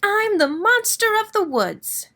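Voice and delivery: high small creature voice; high squeaky little monster; tiny monster voice. Acted. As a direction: announce it proudly but tiny. Tiny monster introduces itself.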